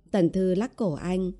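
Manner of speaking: ordinary delivery, nothing marked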